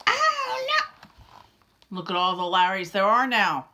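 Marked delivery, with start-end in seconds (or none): 0.1-2.0 squeaky higher oh no; high dismay. Acted. Larry seeing many Larrys, squeaky alarm. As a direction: yelp in dismay.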